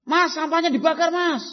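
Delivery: high, loud call; father shouting at his son to burn the trash; yelled order. Acted. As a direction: shout the chore across the yard. Father yelling order from afar.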